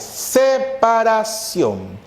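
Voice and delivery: "Separación" stretched very slow, starting on a long s; drawn-out emphasis. Shown as a demonstration. Teacher stressing the meaning of hiato to class, very slow. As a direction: pull "Separación" out syllable by syllable. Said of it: "Separación de vocales".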